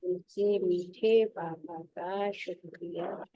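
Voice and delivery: slow, measured devotional recitation; slow ritual greeting. Acted. Speaker reciting Baba's greeting to the spiritual children, slow and devout. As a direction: intone the blessing slowly.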